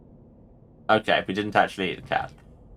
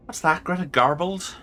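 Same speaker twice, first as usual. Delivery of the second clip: higher-pitched put-on Irish lilt; makeshift Irish accent; 6 semitones higher than usual. Player retries a game character's line in a makeshift Irish accent. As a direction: have a go at Irish. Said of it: "Irish will have to do".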